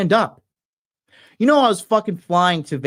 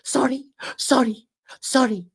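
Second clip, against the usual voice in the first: higher, lightly voiced nervous husband; a hint of a timid man bowing and apologising; mimicking the husband's nervous repeated apologies, higher; mocking meek husband; 5 semitones higher, 4 dB quieter than usual. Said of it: "He was doing this".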